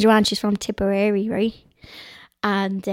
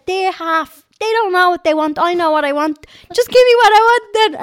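higher and louder, building to a shout; indignant retold rant; 9 semitones higher, 7 dB louder than usual. Retelling demanding chips from the chipper man, building to a shout. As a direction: argue your way to the front of the queue.

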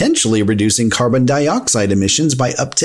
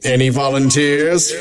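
spooky ghost host. Pretend. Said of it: "I'm good at that voice".

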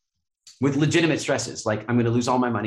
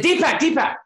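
urgent calling out; 14 semitones higher, 5 dB louder than usual. Retold or imitated. Wayne calling his friend urgently.

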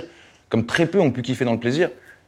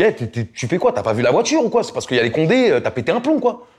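retold angry outburst; 6 semitones higher than usual. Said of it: "je pète un câble sur lui".

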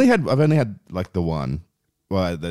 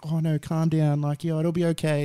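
put-on high soothing girlfriend voice; girlfriend calming him; 10 semitones higher than usual. Girlfriend soothing an angry boyfriend outside the pub, high and coaxing. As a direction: pitch it up, coaxing him to calm down. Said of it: "And she's like".

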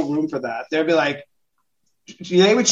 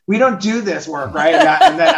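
chuckling through the words; laughing; 9 semitones higher, 6 dB louder than usual. Real, not acted. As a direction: let the laugh spill into it. Laughing at own story.

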